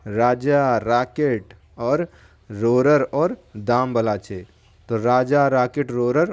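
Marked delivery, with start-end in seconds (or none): 0.1-4.5 names spaced out slowly, one by one; slow, careful dictation of four names. Shown as a demonstration. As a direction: spell the names out slowly for the class. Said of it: "इनके नाम क्या हैं?".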